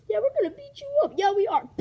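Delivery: high, excited taunt ending in a yelp; cocky threat. Acted. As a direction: crow it loudly, then yelp. Villains threaten, then get hit.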